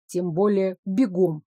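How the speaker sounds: usual speaking voice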